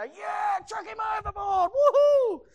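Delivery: high, loud whooping shout; rowdy hammed-up cheer to throw him over; angry mob yell. Imagined sailors turning on Jonah, wild yelling. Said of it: "get angry and go".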